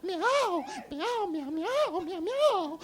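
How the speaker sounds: relieved kitty talk. Acted. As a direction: meow with relief that it's over. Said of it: "the lady said in a sigh of relief".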